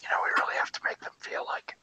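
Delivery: fast apologetic whisper. Real, not acted. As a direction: whisper an excuse quickly. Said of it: "I'm playing it up".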